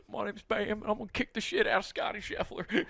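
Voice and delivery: high and hushed, a lightly voiced skater brawler; hushed high mock tough guy; Bam Margera. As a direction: squeak it out as a rowdy Philly skater. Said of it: "Bam Margera comes out".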